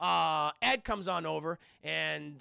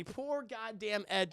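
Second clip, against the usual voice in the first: ordinary talk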